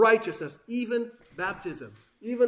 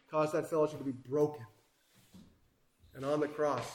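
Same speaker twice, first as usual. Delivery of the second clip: ordinary talk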